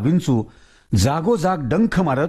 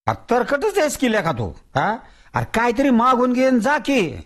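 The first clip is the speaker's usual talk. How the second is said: higher, coaxing village elder; coaxing Tatya; 9 semitones higher than usual. Acted. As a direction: sweet-talk the stubborn ghost.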